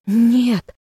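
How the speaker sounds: high, loud burst of refusal; desperate cry. A narrator's reading. Heroine refusing to accept friends are lost, desperate cry. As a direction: cry out in disbelief.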